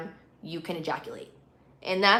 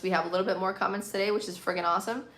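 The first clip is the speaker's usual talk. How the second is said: normal talking voice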